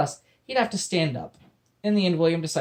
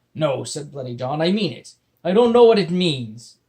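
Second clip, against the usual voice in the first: pirate insisting; 2 semitones higher, 8 dB louder than usual. Acted. Pirate voice in story.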